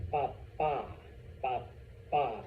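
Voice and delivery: "papa" as pa-PA, syllables separated; a measured "papa" with long second syllable; stress on final A. Shown as a demonstration. Teacher returns to word for dad, "papa" stressed pa-PA. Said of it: "extra stress on the A".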